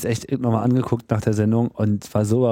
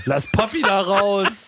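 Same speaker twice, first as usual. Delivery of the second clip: mock indignant cry; 8 semitones higher, slower than usual. Joking retort, raised voice.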